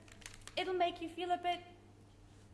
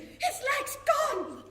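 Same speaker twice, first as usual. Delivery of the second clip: slow, high, louder gasp of shock; shocked cry; 9 semitones higher, 6 dB louder, much slower than usual. Acted. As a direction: cry it out in horror, drawn out. Mother sees son's missing leg.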